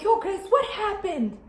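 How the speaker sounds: high, drawn-out shocked question; slow, high cry of alarm; alarmed relatives. Retold or imitated. Mom and aunt finding her bandaged, alarmed. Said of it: "they come and they're like".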